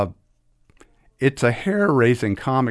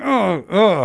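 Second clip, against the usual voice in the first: loud, high mock groan; editor's dismayed groan; 9 semitones higher, 5 dB louder than usual. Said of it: "some editor, you know, going".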